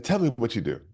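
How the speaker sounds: high-pitched amateur British impression; bad British accent. British mentor questioning new client, imitated British accent. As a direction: put on a clumsy British accent. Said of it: "That's my British accent".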